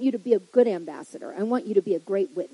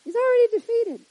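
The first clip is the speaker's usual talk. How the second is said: much higher, louder, ringing already defeated; triumphant cry; 12 semitones higher, 5 dB louder than usual. Shown as a demonstration. Preacher exclaiming the devil is already defeated, high and loud. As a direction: proclaim victory over the devil. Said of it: "repeated higher".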